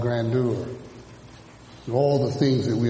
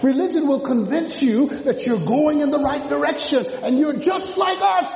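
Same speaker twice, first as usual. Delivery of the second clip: preaching at full force; 12 semitones higher than usual. Acted.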